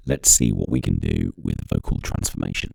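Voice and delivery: slowed-down monster-deep effect; pitch shifted voice. Shown as a demonstration. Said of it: "that's pitch change".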